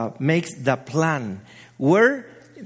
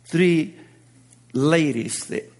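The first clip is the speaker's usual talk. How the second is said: normal talking voice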